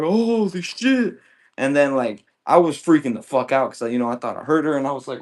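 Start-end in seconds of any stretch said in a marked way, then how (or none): 0.0-1.2 stunned realisation. Retold or imitated. Realises he tackled his girl.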